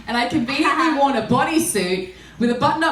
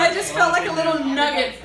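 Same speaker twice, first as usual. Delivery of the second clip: laughing; 4 semitones higher, 4 dB louder than usual. Real, not acted. Laughing while telling the story.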